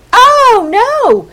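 high, loud "oh, no"; emphatic correction. Shown as a demonstration. Preacher correcting his old reading, emphatic. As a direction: knock down the mistaken reading firmly.

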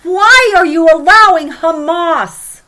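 loud, slow cry of despair; a people crying out to God; anguished outcry. Retold or imitated. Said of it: "cry out that same exact phrase".

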